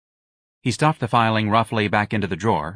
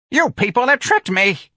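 put-on much higher, louder old-lady snap; snapping old woman; 5 dB louder than usual. A narrator's reading. Old clerk snapping at her captors. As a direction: snap it out like a bear trap. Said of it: "teeth snapped like a bear trap".

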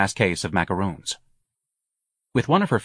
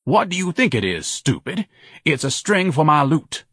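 haughty, indignant voice, mostly higher; pompous man put out at being questioned; pompous, irritated Ignatius; 5 semitones higher, 3 dB louder than usual. Acted. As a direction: dismiss the policeman with lofty annoyance.

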